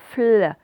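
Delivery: "Fl" loud, f sliding into a held l; isolated blend sound. Shown as a demonstration. Teacher showing pupils F joined to L, held fl blend. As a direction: sound out "Fl" with the l lengthened. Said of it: "L'association du F et du L".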